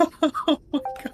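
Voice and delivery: very high, slow wail through laughter; laughing wail. Acted. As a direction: cry out between laughs. Player wails laughing.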